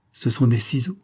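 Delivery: ordinary delivery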